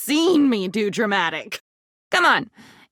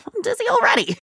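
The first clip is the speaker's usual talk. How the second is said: high, crying shout; an overwhelmed cry; dizzy wail; 12 semitones higher, faster than usual. Acted. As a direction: wail it as the room spins. Outburst about spinning.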